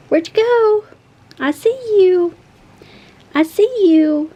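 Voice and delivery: high sing-out to a hiding pet; playful calling. Real, not acted. Owner spotting her hiding cat, playful high call.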